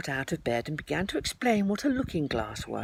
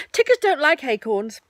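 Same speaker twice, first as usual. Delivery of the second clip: higher, louder firm Tigger; firmly; 9 semitones higher, 7 dB louder than usual. A narrator's reading. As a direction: lay down the rule. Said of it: "he said firmly".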